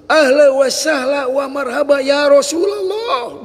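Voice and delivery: loud, high, slow joyful greeting call; joyful welcome. Acted. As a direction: welcome an honoured guest with a happy shout. Master joyfully greets Rasulullah.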